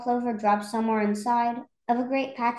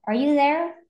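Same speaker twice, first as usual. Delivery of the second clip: higher, louder call into the clover; 5 semitones higher, 5 dB louder than usual. A narrator's reading. Horton calling to his tiny friends, calling out. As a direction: call out searching for someone. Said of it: "and called".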